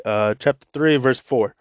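ordinary delivery

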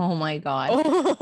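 drawn-out, slow "Oh my God" in a put-on voice; mock shock. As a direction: stretch it like a fake gasp of disbelief. Retold reaction to the price.

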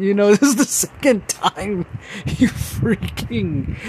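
high, laughing, half-choked complaint; laughing through tears. Real, not acted. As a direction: laugh it off while still a bit teary. Laughs at tearing up in theater.

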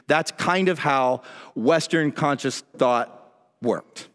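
ordinary delivery

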